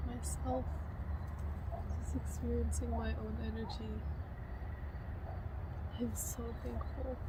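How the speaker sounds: hushed, tender words, partly whispered; soft affirmations. Real, not acted. Alone after the haircut, softly thanking herself and God. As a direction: murmur love and thanks gently to yourself.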